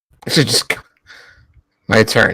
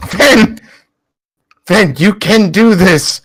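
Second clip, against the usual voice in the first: higher, slower pleading pep talk; in-character encouragement; 4 semitones higher than usual. Acted. Otter urging Finn to believe, higher encouraging voice. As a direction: cheer on a friend in a raised voice. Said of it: "To be like".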